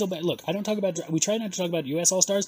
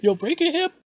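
mock warning; 13 semitones higher than usual.

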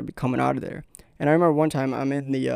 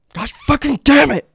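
furious yell; 12 semitones higher, 11 dB louder than usual. Retold or imitated. Kid yells, throws phone.

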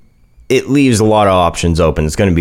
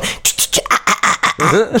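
a half-hearted imitation of the spooky hiss breaking into a laugh; whispered ch-ch, then giggly high ah-ah; mock creepy hiss; 11 semitones higher, 3 dB quieter, faster than usual. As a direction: girlfriend mocking his creepy noise. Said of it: "giving me that wise face going".

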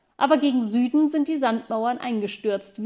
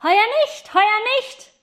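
high, loud call; boy calling down to the workers, high and loud; 11 semitones higher, 6 dB louder than usual. Acted. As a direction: shout it down from the flying goose. Boy calls down from the sky.

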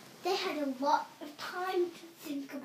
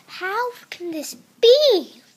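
loud, higher, slow disbelief; surprised; 8 semitones higher, 11 dB louder, slower than usual. Acted. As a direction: cry out in amazement. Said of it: "the dad was surprised".

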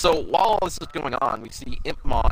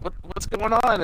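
higher, puzzled voice; confused Impmon; 7 semitones higher, slower than usual. Retold or imitated. Impmon lost inside the vision, mildly confused. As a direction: ask in bewilderment. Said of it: "Ipmon's just like".

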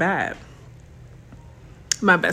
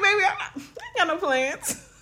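laughing quote; 10 semitones higher, 4 dB quieter than usual. Retold or imitated. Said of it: "I be like".

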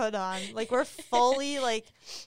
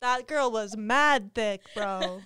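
bro alter ego; 4 dB louder than usual. Acted. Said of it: "I'm coming out of my alter ego now".

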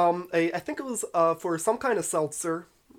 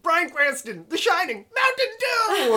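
higher with each item, last one drawn out; mock ad hype; 10 semitones higher, 3 dB louder, slower than usual. Critic mocking a commercial that doesn't even try, building hype. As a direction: build to a high, slow, over-the-top product shout.

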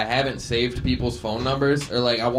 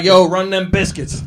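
mock inmate demand; 6 semitones higher, 6 dB louder than usual. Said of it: "He's like".

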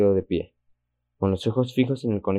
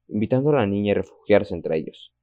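ordinary delivery

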